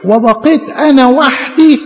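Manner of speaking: high, drawn-out wail of loneliness; Elijah's lament. Acted. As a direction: plead in a slow, high lament. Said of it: "قال له".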